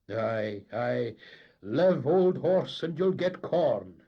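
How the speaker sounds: slow, loud, drawn-out proverb; wry old skipper. A narrator's reading. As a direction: drawl it out, dry and mocking. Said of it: "the captain would say".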